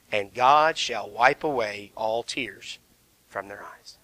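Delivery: almost breathe the words of comfort; hushed tender reading. Acted.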